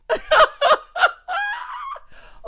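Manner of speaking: very high laughing shriek; shrieking laughing scream. Real, not acted. Viewer shrieking with laughter at would ya.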